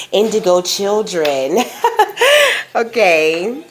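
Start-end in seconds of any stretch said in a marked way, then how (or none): 1.6-2.7 short bright burst of laughter; high laugh. Real, not acted. Laughs at own reading.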